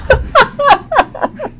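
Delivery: high burst of startled laughter. Real, not acted. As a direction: laugh out the fright. Laughs after dog scare.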